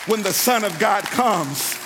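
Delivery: loud, driving sermon delivery; full-force preaching. Real, not acted. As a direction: build the retold dialogue into a forceful sermon climax.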